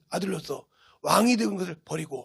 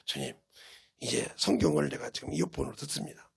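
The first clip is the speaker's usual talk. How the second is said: soft prayer; 6 semitones lower, 8 dB quieter than usual. Retold or imitated. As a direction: lower and much softer, a murmured prayer. Prays while listening to Bible.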